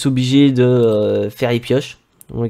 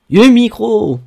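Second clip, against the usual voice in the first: excited exclamation; 8 semitones higher, 3 dB louder, slower than usual. Real, not acted.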